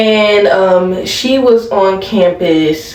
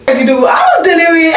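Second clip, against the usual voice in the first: mock shout; 10 semitones higher than usual. Mock voice of the crazy dude.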